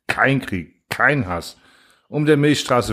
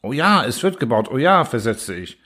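usual speaking voice

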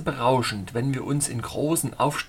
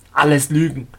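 loud, scornful denial; contemptuous dismissal; 8 dB louder than usual. A narrator's reading. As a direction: wave it away with your voice. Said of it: "winkte verächtlich mit der Hand".

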